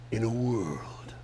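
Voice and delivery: slow whisper of a trailer voice; whispered trailer line. Retold or imitated. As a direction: breathe the trailer line slowly. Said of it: "the movie trailer guy".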